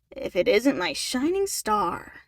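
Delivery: slow, smug charmer; slow oily flattery; smug man voice. A narrator's reading. Ian smug greeting.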